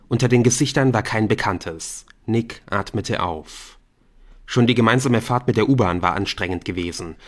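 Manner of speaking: usual speaking voice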